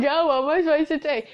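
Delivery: fast, laughing protest; giggling fake despair; laughing mock whine. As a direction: blurt it out through laughter. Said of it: "I'm like".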